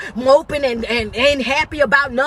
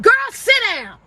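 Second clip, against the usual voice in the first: dismissive scolding; 8 semitones higher than usual. Acted. Scolding an imagined latecomer.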